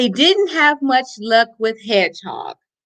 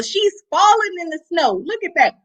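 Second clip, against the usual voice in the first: ordinary delivery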